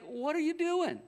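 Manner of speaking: high-pitched, exasperated prophet; acted-out indignant scolding; ticked off. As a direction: demand it in mock outrage. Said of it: "Elisha's legitimately ticked".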